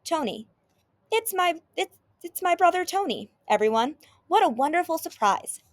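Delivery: high, stammering delight; amazed host greeting an unexpected brother; completely surprised. A narrator's reading. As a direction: stumble over the words in astonishment. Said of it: "Bob looks completely surprised".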